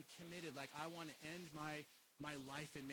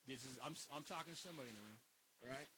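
ordinary delivery

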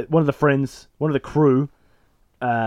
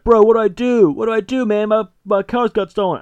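high-pitched mock panic; freaking out; 8 semitones higher, 4 dB louder than usual. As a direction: play a hammed-up frantic victim. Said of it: "Frankie's freaking out".

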